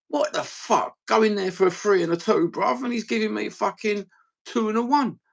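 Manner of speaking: exasperated, high-voiced complaint; indignant rant. Real, not acted. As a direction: protest in disbelief, pitch climbing steeply. Ranting about short-changed pie order.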